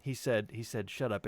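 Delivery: usual speaking voice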